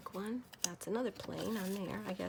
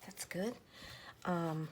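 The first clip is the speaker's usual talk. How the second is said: normal talking voice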